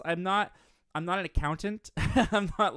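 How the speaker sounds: usual speaking voice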